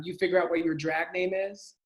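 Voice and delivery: ordinary delivery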